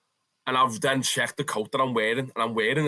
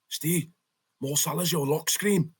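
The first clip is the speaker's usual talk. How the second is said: put-on blagged Scottish accent, pitched higher; hammed-up Scottish accent to pass as a home fan; bad fake Scottish accent; 5 semitones higher than usual. Nudging his mate Steve among Rangers fans, in a bad fake Scottish accent. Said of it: "in the most blag Scottish accent ever".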